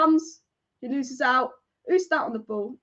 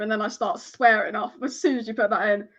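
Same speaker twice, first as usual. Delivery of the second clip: ordinary delivery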